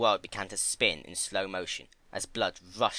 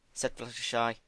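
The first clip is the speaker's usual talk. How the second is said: plain reading voice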